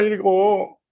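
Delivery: ordinary delivery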